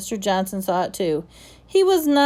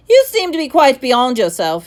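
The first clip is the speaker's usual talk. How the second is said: sharp, high and loud rebuke; sharply; 5 semitones higher, 9 dB louder than usual. Acted. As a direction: snap the words at him. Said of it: "he said sharply".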